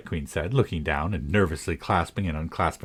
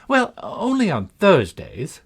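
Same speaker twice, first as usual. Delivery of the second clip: put-on high, slow, fluttery old queen; a dithery queen, slow and lilting; 12 semitones higher, 4 dB louder, slower than usual. A narrator's reading.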